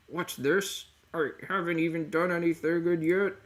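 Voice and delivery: high-pitched cartoon character voice; Belgum E O. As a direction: play a puzzled cartoon oddball. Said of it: "I'll just be doing the voice".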